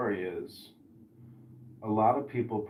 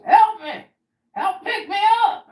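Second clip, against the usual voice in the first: very high, loud yelled plea; old man yelling for help; 10 dB louder than usual. Retold or imitated. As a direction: bellow for someone to lift you. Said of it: "he goes".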